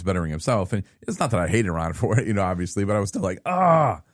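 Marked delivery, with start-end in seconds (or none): 3.5-4.0 high, loud frustrated outburst; frustrated groan. Retold or imitated. Friend left him stuck at work, an annoyed high "Ah". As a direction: let out a sharp annoyed "Ah". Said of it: "I was mad".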